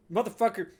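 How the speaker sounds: loud, fast snapped curse; angry curse. Acted. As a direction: spit it out on the run. Character running, cursing.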